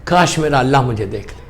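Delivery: very low, soft, imagined prayer; quiet anguished longing. Retold or imitated. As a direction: murmur the imam's wish with restrained yearning. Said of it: "تو امام نے تڑپ کے کہا". (دھیمی آواز میں تڑپتی آرزو)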